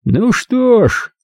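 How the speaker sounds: a slow, high, mocking drawl; lightly voiced sneering mobster; sarcastic. A narrator's reading. Lupo greeting his wife with open sarcasm. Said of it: "голосом, полным сарказма".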